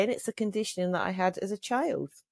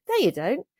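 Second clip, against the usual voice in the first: a higher, louder mock contradiction; doubting inner voice; 7 semitones higher, 4 dB louder than usual. Inner critic shooting down her self-praise, pitched up and louder. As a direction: snap back as the nagging inner voice. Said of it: "these voices piling and going".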